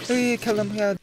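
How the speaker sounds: usual speaking voice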